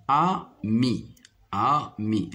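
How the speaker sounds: "Ami" split as a, pause, mi; syllable by syllable. Shown as a demonstration. Teacher showing pupils two syllables in "ami", syllable by syllable. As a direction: sound out both syllables of "Ami". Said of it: "se compose de deux syllabes".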